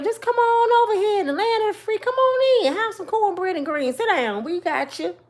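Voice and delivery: high, sing-song hostess welcome; mock welcoming host. Comic playing America inviting immigrants in with a gushing welcome. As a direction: ham up a gushing come-on-in welcome.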